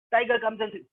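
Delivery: usual speaking voice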